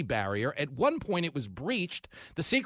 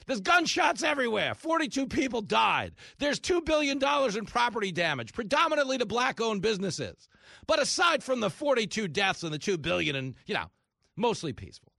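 higher, sing-song mock report; mock news anchor; 6 semitones higher than usual. Host mocking news coverage of riots, higher. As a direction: play the dishonest reporter. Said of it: "that's what we were told".

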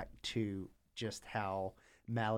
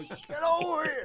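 much higher, louder call to the grandkids; mock calling dad; 10 dB louder than usual. Doting dad calling grandkids over, loud and high. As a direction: yell it out like an overeager grandpa. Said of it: "Grandkids are embarrassed".

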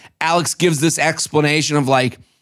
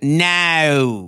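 drawn-out Aussie "No"; Australian accent; 3 semitones lower than usual. Shown as a demonstration. Showing off "No" in a fake Australian accent. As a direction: put an Australian twist on "No". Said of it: "I can say no in an Australian".

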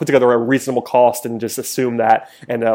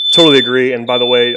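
raised voice over loud beeping; shouting over fire alarm; 11 dB louder than usual. Real, not acted. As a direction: talk loudly over an alarm. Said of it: "forgive the beeping in the background".

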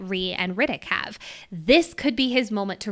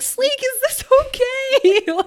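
much higher, slow, dainty polite question; polite asking; 5 dB louder, slower than usual. Retold or imitated. Violet politely asking the dragon midair, high sweet voice. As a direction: ask sweetly mid-leap, high and slow. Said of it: "just being like".

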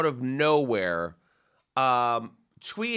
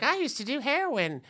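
mock airy girl; 11 semitones higher, 4 dB quieter than usual. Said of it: "she was like".

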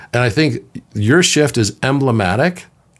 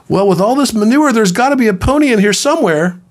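put-on high, louder childlike voice, bursting with hope; eager little boy; 12 semitones higher, 4 dB louder than usual. Optimistic boy telling the psychiatrist about the pony, high and eager. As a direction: play the beaming kid sure there's a pony.

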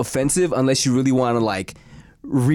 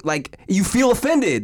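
ordinary talk

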